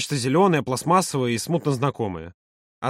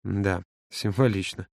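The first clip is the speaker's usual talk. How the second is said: slow, low, soft drawl; drawn out; 7 semitones lower, 6 dB quieter, slower than usual. A narrator's reading. Volodya replies out of step to Yura, in a drawl. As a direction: draw it out quietly, as if absent. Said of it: "невпопад протянул Володя".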